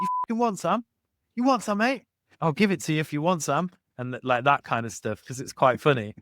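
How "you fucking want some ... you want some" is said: high, put-on voice of a tiny old football fan, speeding up at the end; Wealdstone Rangers fan. Tiny old football fan squaring up for a fight, high put-on voice. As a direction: square up like a little old man picking a fight. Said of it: "He's like, the Wealdstone Rangers".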